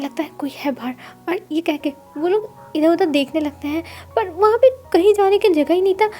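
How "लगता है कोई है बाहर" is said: much softer, wary hush. Acted. Wing hushed to Wangji on hearing noise outside, wary.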